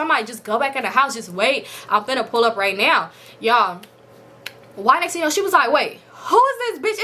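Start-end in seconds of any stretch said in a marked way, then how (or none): 5.7-7.0 slow, higher startled question; alarmed surprise. Retold or imitated. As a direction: spot a stranger and freeze. Sister spots stranger, alarmed.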